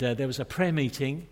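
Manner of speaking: ordinary talk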